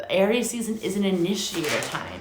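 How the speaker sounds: usual speaking voice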